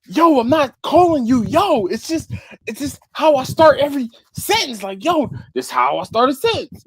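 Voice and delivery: high-pitched, insistent self-defense; exasperated protest. Retold or imitated. Explaining his habit to coworker, high insistent exasperation. Acted quarrel, explaining himself.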